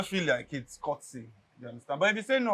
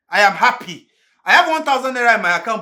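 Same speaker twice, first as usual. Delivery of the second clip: defiant boast; 5 semitones higher, 10 dB louder than usual. Acted.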